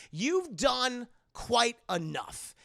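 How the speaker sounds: slow, measured put-down; deliberate acted scolding; stern rebuff. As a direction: shut the offer down word by word. Stark coldly rebuffs Peter.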